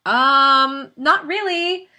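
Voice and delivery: high-pitched hesitant denial, drawn-out um; awkward mom. Retold or imitated. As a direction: reenact stalling with a loud, high um. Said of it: "I was like".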